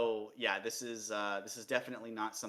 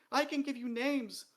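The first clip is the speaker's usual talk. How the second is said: high, louder, eager plea; desperate offer; 13 semitones higher, 5 dB louder than usual. A narrator's reading. Dee offers names to the king, desperately. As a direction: grovel to save himself.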